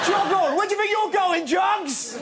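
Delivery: high, cheeky yell; heckling shout. Acted. Rude baby yelling after its mother, high heckling shout. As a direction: call after her like a lout.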